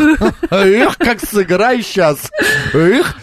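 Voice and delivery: high, drawn-out playful whoops; mock musician's gusto. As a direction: launch into it like a showy player, half laughing. Pretends to be about to play.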